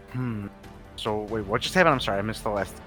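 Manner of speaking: ordinary talk, nothing marked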